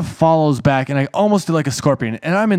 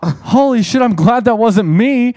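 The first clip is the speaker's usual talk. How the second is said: high, louder relieved exclaim; mock relieved girlfriend; 10 semitones higher, 5 dB louder, slower than usual. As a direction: sound shocked then relieved it wasn't you. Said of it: "she's all".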